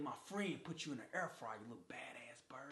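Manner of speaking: unvoiced muttered jab; whispered insult. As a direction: hiss it under breath.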